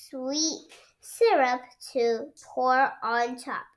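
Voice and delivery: usual speaking voice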